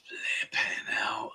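slow, despairing whispered curse. Real, not acted. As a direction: breathe it out in defeat. Losing the quiz round.

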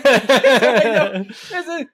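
high, loud, laughing through the quote; laughing caller. Retold or imitated. Retelling the rivals' call, imitating them laughing. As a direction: mimic them cracking up on the phone. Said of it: "They were laughing hard".

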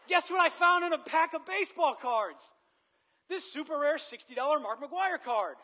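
high, loud, overly bright "guess what" voice; hammed-up innocent kid showing off the card; fake innocent excitement. Feigning innocence showing stolen card.

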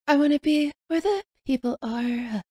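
breathy imitation of airy singing. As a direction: sing it light and airy. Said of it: "The airy voice".

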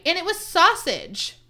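slow, high, loud disbelief; appalled reveal. Retold or imitated. Podcaster revealing the botched breakfast wrap, appalled. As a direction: draw it out as the final outrage.

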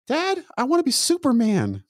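put-on high-pitched little kid voice; little boy. Son telling his dad what he wants to be, little boy voice. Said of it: "Holding my little man's hand".

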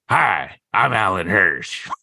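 slow put-on dubbing voice; drawn-out bad kung fu dub voice; Alan Hirsch. Dubbing Alan Hirsch's moving mouth for a joke endorsement, slow put-on voice. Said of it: "I would do my voice".

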